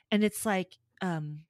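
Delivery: ordinary delivery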